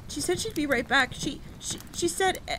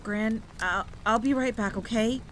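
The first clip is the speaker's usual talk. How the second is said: hurried, louder, higher stammer; quickly told; 4 semitones higher, 7 dB louder than usual. Acted. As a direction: blurt it out in a rush. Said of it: "I quickly told her".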